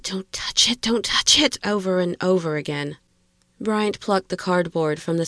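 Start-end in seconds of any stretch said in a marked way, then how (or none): 0.0-1.6 panicked hissing, from whisper into voice; urgent hiss. A narrator's reading. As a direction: hiss it twice, more urgent the second time.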